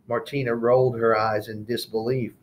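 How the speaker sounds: plain reading voice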